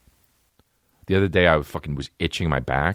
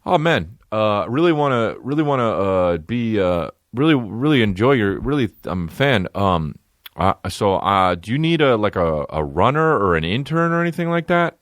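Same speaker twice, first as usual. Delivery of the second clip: higher-pitched fan voice, halting on the uhs; mock awkward admirer, pitched up; hesitant eager fan; 6 semitones higher than usual. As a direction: imitate a nervous fan fumbling a request. Imitates an awkward fan approaching.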